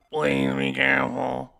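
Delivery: slow, eerie ghoulish female voice; drawn-out spooky woman's voice. Acted. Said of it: "that ghoulish voice for, uh, Elora".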